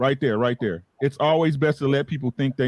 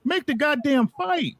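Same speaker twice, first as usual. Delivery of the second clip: exasperated; 10 semitones higher, slower than usual. Real, not acted. Repeated exasperated demand.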